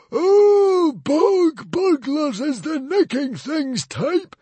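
very high, loud, slow screech; the librarian screeching back at the mother. A narrator's reading. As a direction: screech it out in scorn. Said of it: "screeched the hairy, scary librarian".

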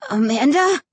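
very scared. A narrator's reading. Scared brother calls to his sister in the dark, frightened. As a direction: call her name with frightened uncertainty. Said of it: "Josh sounded very scared".

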